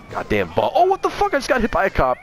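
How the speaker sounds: very high-pitched shocked yelp; shocked outburst. Real, not acted. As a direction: squeal in outraged surprise. Suddenly hit by a cop.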